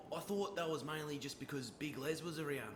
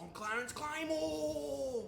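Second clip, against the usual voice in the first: high, drawn-out battle cry of a name; from the chest; 11 semitones higher, 4 dB louder, much slower than usual. Acted. Trainee proving himself to his coach, rousing shout from the chest. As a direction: bellow your name with full conviction. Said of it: "Say it with your fucking chest".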